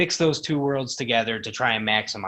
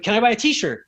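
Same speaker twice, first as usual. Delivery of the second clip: eager fan voice; 10 semitones higher, 4 dB louder than usual. Retold or imitated. Nervous fan asking Steen.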